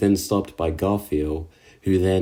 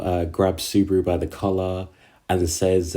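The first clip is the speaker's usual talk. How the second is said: ordinary delivery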